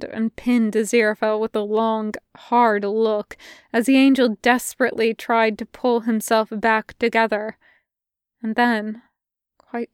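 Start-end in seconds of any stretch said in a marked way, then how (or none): none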